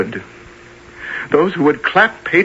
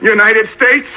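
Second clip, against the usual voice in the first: furious outburst; 8 semitones higher than usual. Acted. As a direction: explode against the country. Defendant's outburst in court.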